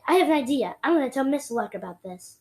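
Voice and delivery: a touch of excitement, kept light; mildly excited. A narrator's reading. Teacher sharing an idea, mildly excited. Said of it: "Miss Richmond seemed excited".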